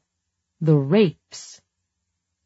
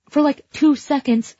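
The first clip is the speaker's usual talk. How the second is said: high and louder, defensive; high defensive answer; 9 semitones higher, 4 dB louder than usual. Acted. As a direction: rush to defend yourself.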